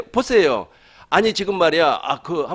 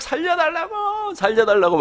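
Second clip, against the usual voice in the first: mock cry for help; 14 semitones higher than usual. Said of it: "막 소리를 지르는 겁니다".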